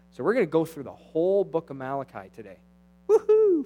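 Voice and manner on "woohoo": sarcastic high whoop; playful fake cheer from the pulpit; mock cheer. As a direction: whoop like it's thrilling news. Mock cheer over Malachi.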